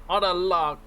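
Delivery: mock show host. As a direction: announce it like a showman. Repeats the announcement.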